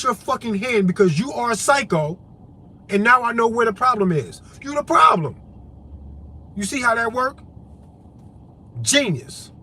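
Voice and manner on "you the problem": sudden higher, louder jab; emphatic shout. Real, not acted. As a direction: throw the accusation right at them. Calling out the accuser.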